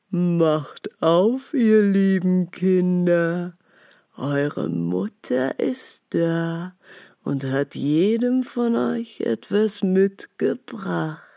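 slow, rough wolf voice faking kindness; a big bad wolf drawing it out, trying to sound friendly; wolf's rough voice. Wolf at the door coaxing the kids, slow and rough. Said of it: "hörten an der rauen Stimme".

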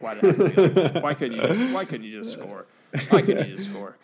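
loud, high exasperated complaint with a laugh; throwing up your hands at the bad luck. Real, not acted. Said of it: "exasperated at the own-goal bounce".

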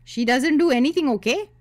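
high, loud cut-in from the mother; pushy Indian mother, high and loud; mother interjecting. Acted. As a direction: jump in high and loud over her daughter. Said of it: "my mother interjected again".

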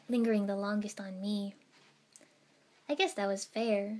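plain reading voice

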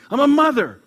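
loud, high proud retort; indignant. A narrator's reading. Mother retorting to the clerk, loud and proud. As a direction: fire it back, wounded pride. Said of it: "snapped Emily".

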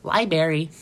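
Library said as LIE-berry; Library ending in berry; mocked mispronunciation. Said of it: "people say library".

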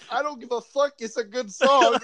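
high, pained defiant line; mock defiance. Heartbroken listener shrugging off the song, high and pained. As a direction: pretend you don't care while it hurts. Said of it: "you're like".

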